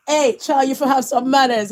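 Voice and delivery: ordinary talk, nothing marked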